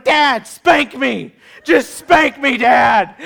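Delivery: loud, high, hammed-up begging; comic retold desperate yell; desperate pleading shout. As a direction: beg Dad at full volume, drawing out the words. Boy begging dad, acted out.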